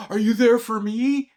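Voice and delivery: very high, mock-needy question; hammed-up pleading, rising at the end; mock yourself begging for attention; needy plea. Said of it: "the total opposite of like".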